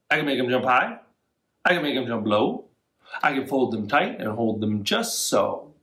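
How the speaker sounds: usual speaking voice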